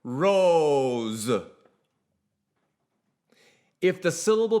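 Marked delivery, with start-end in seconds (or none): 0.0-1.3 "rose." ending in a buzzed z and a tiny uh; "rose." sung with a quick shadow vowel on the rest. Shown as a demonstration. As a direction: let "rose." release into a brief neutral uh after the z. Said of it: "For example".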